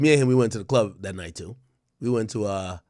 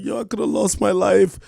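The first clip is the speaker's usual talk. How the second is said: higher, plaintive imitation of a tearful man; mock crying; 6 semitones higher, 4 dB louder than usual. Acting out the moment fans await, mock crying. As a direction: imitate a tearful confession, pitch lifted. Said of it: "he's crying and like".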